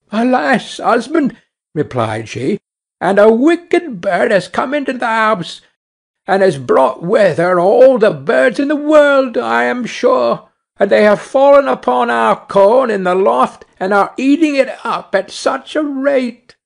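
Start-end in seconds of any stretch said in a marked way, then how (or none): 0.1-1.3 lightly voiced wife, higher; a touch of a fretful wife; wife frets back to her husband; carter's wife. A narrator's reading. 3.0-16.5 lightly voiced wife, higher; a touch of a fretful wife; wife frets back to her husband; carter's wife. A narrator's reading. Wife complains about the bird.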